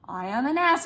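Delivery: normal talking voice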